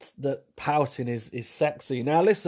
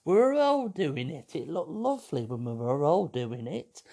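put-on old lady voice; 2 semitones higher than usual. The pouter at eighty defends old photos in an old lady voice. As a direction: reminisce like a pensioner about her youth. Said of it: "you're gonna go".

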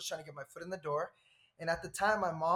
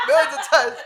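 laughing through the words, louder; 9 semitones higher, 18 dB louder than usual. Real, not acted. Telling friends about West Hollywood, laughing. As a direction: chuckle it out.

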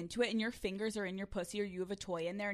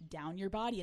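normal talking voice

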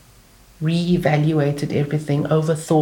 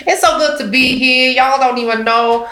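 put-on Southern American accent; 7 semitones higher than usual. Comedian greeting the crowd in a Southern American accent. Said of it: "down South American accent".

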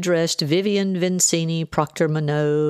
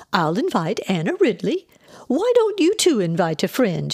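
high, lilting suggestion; eager aunt; 5 semitones higher than usual. A narrator's reading. Aunt planning a dinner party, eager lilt. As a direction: swoop up high as the plans form.

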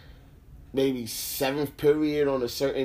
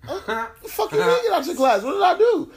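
much higher, louder indignant disbelief; retold outrage; 4 dB louder than usual. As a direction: squeak out the shock of being thrown out, half laughing. Kicked out of class, retold.

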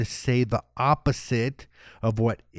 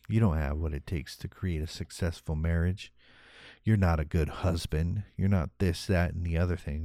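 low, quiet critical inner voice; 6 semitones lower, 5 dB quieter than usual. Retold or imitated. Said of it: "the negative voice creeps in".

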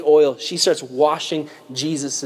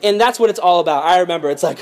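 ordinary talk, nothing marked

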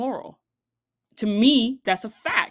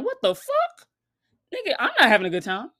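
much higher, fast, outraged disbelief; 6 semitones higher, 4 dB louder, faster than usual. Retold or imitated. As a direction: yelp the first words, rush the rest. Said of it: "Now all of a sudden you like".

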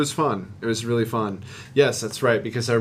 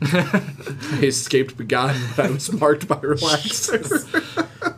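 slow words through laughter; laughing; 5 semitones higher, slower than usual. Real, not acted. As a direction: laugh while saying it. Laughing at own joke.

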